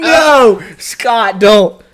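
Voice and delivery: high, loud cry of dismay; anguished shout. Real, not acted. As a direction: yell no in horror. Dreading a rival's draft pick.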